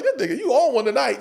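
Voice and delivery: high, laugh in the voice; retold laughing reaction. Comics laughing at Kevin's riffing, retold high and amused. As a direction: imitate cracking up at a friend. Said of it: "We just laughed like".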